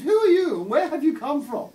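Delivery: loud, high-pitched posh English lady; shrill posh accent. Acted. Posh lady challenging a stranger in her garden, shrill accent. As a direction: demand it in a shrill upper-class accent. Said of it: "she said in this shrill accent".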